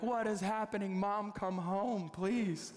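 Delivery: high-pitched mock panic; retold freak-out. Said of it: "I'm freaking out".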